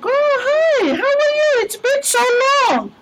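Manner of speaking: high sing-song mock-friendly greeting; gushing greeting. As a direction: overdo the delighted welcome. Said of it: "And so I'm like".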